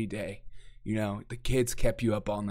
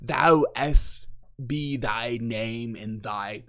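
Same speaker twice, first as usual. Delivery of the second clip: a hint of archaic, slow at the end; mock old English; much slower than usual. Mocking old-fashioned speech, lightly archaic. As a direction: ham up olde speech. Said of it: "we don't call each other lords".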